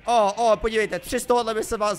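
loud excited oh-oh; excited exclamation. Real, not acted. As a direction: burst out at the find. Sudden find in the game.

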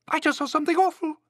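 very high, fast squeak; frightened mouse cry. A narrator's reading. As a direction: panicky little mouse voice. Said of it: "cried the mouse".

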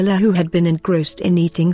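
usual speaking voice

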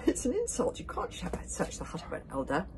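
high, flustered objection; protesting. A narrator's reading. Elder defending the witch from a search, protesting. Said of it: "protested Nobber Nobrace".

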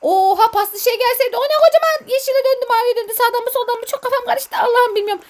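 high-pitched flustered rush; panicked. Real, not acted. Player facing huge rusty thing, unsure which way, panicked. Huge monster appears, must choose path.

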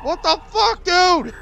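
loud sharp "Stop!"; sharp shout. Real, not acted. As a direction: yell at the game to quit it. Controller shaking on the floor.

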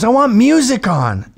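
slow, high, loud whiny demand; a drunk guest demanding music at karaoke; mock drunk demand. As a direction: draw it out like a spoiled guest. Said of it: "she goes".

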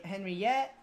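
ordinary talk

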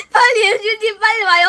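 high, loud, hurried calling; urgent, laughing call. Real, not acted. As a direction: call the teammate over, panicking. (웃으며 다급하게 부르기)

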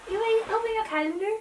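put-on high, fast baby talk to a dog; dog mom voice. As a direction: coo at the puppy, high and quick. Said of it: "my dog mom voice".

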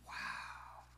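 whispered wow. Acted. Breathy aside after the story.

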